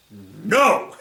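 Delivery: gruff-tempered refusal yelled back; mock yell in a put-on character voice; yelled reply. A narrator's reading. Spider yells down at the girl, yelled refusal. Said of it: "he yells back".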